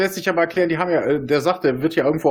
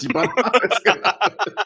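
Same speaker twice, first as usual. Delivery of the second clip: laughing; 5 semitones higher than usual. Pretend. Repeated laughing.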